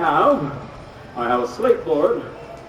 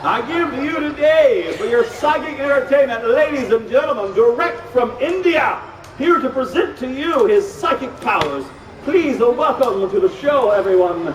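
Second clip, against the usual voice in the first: grand ringmaster introduction, pitched up; show announcer; 7 semitones higher than usual. Acted. Host introducing a psychic to the audience, grand announcer. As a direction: build the act up for the crowd, stretching key words.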